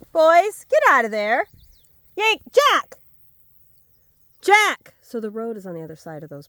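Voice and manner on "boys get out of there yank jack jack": loud, high real shout; yelling at dogs. Real, not acted. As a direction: call sharply to dogs straying off. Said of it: "Sorry, I yelled at you".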